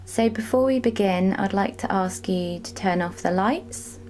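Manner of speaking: unhurried meditation voice with long pauses; measured, spacious relaxation-guide delivery; guided meditation. Real, not acted. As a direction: guide them gently through a body scan, leaving space between phrases. Leads a body scan meditation.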